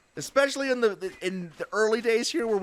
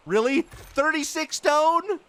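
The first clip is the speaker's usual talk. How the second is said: disbelieving; 9 semitones higher, 5 dB louder, slower than usual. Real, not acted. Short on stone, disbelief.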